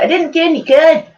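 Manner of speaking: a high, put-on Scottish accent; playful fake Scottish accent, pitched well up; mock Scots accent. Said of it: "so I can come out with an accent".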